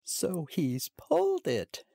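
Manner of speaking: high, slow tearful mockery; high tearful mocking cry. A narrator's reading. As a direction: sneer through tears, high. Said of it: "he exclaims in a tearful but at the same time mocking voice".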